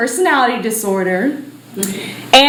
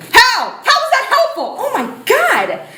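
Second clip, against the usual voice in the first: incredulous outburst; 11 semitones higher than usual. Acted. Ashley protesting the pill advice, high and incredulous. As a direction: throw the question back in shock.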